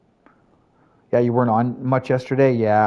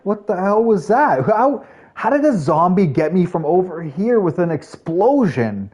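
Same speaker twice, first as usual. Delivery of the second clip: higher, mock-indignant disbelief; baffled outrage; 6 semitones higher than usual. Retelling his shock at the grenade, higher and indignant. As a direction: act stunned and offended. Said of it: "I was like".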